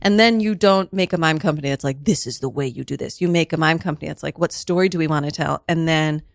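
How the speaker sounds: ordinary delivery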